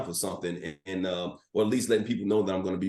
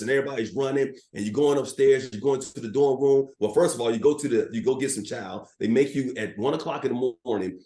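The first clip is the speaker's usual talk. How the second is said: ordinary talk, nothing marked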